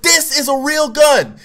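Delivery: high, slow, furious shout; mad cop yelling. Retold or imitated. Cop putting his gun in boy's face, angry shouting. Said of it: "the cop was so mad".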